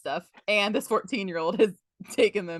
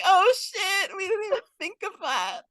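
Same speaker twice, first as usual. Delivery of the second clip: high-pitched mock wail; whiny fake crying on the edge of laughter; crying, upset; 11 semitones higher than usual. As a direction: imitate tearful, panicked regret. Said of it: "they're all crying, upset".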